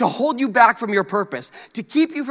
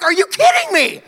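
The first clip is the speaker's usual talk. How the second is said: outraged disbelief; 13 semitones higher, 5 dB louder than usual. Retold or imitated. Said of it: "I'm like".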